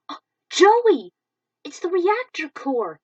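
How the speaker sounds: sudden high shocked call; startled by the reactor core; alarmed cry. Acted. Yuki shouting to Joey in alarm.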